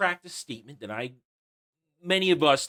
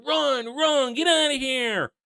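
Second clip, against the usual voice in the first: mock yell; 10 semitones higher, slower than usual. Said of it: "He's yelling".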